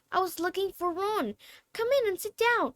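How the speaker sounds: put-on girl's voice. Girls voiced higher.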